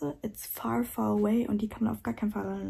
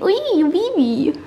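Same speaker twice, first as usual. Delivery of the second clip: higher, slow cooing; 7 semitones higher, 10 dB louder, much slower than usual. Acted. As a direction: coo it tenderly. Cooing at puppy.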